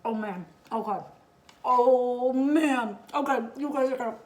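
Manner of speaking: talking through a mouthful of food; words muffled by a big bite; mouth full. Real, not acted. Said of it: "sorry, I'm talking with my mouth full".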